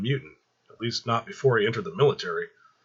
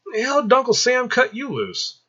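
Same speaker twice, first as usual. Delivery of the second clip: much higher, thrilled greeting; excited; 5 dB louder than usual. Acted. Michael greets his old friend Arthur, thrilled and much higher. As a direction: burst out at seeing an old friend. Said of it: "looked over in excitement".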